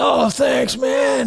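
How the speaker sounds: high-pitched mock thanks; grateful stranger. Stranger thanks him for the warning, mock grateful.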